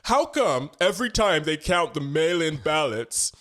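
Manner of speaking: put-on high American accent reading the tweet; Trump impression. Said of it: "There was a tweet where he was like".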